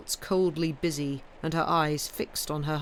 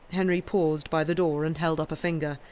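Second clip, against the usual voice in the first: ordinary delivery, nothing marked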